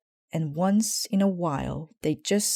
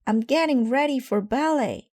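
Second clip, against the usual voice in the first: put-on high, loud kid voice; child shouting; 8 semitones higher, 3 dB louder than usual. A narrator's reading. Sister shouting down to Mama, still yelling. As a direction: call it down the stairs.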